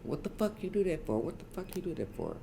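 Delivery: mock yell, very high but held back; imitated yelling. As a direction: repeat the outcry high-pitched without full volume. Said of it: "but I'm hearing yelling".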